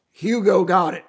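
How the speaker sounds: imitated Southern dad repeating himself; strong Southern accent. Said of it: "they got strong Southern accent".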